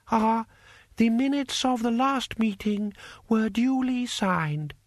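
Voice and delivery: high, slow, careful servant voice; timid eunuch. A narrator's reading. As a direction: report hesitantly to a feared master.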